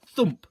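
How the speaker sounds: a loud dull thud word; thump sound. A narrator's reading. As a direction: drop it like a sandbag. Sound effect in story.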